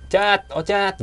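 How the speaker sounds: high, loud call to a friend; mock yell, pitched right up; retold shout. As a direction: imitate calling out to someone far off.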